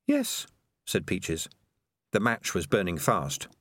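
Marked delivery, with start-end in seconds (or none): 0.1-0.5 put-on high, earnest young rat girl; young female rat voice. A narrator's reading.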